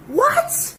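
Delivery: very high, shocked what; stunned what. Retold or imitated. Hearing her friend fled home, a high stunned what. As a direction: yelp it in disbelief.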